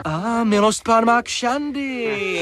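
high, slow, drawn-out gloating; mocking. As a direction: stretch it out to mock your prisoner. Captor mocks prisoner's braces.

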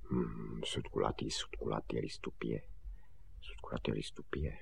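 very soft, low mumbling; hushed, half-whispered rote repetition; repeating to himself. Acted. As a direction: memorise it under your breath. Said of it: "Opakoval si Honzík".

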